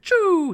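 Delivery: high, loud "choo" sound effect; whizzing lure noise. Retold or imitated. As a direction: imitate something shooting back past you. Imitates lure flying back.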